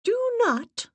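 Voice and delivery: high, forceful schoolmistress tone; stern headmistress. A narrator's reading. Assistant warning the séance sitters, stern headmistress tone. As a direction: lay down the rule like a headmistress. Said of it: "in a tone that reminded me of the headmistress".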